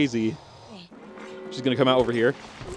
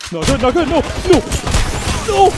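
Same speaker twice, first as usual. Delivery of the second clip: high loud panicked cry; 8 semitones higher, 3 dB louder than usual. Real, not acted. Gamer under fire, crying out in panic. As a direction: yelp in panic as they close in.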